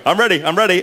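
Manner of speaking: high, excited retold cry; acted-out eagerness; eager announcement. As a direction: announce it like rushing back onstage. Retold eager return to stage.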